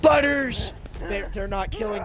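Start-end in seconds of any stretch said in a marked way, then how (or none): 0.0-0.7 shout. Real, not acted. Calling out in game.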